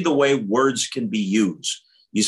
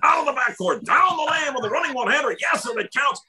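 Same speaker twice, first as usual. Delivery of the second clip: high-pitched play-by-play call in a broadcaster impression; Marv Albert. Young broadcaster imitating his idol's basketball call, high announcer impression. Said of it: "We started out imitating Marv Albert".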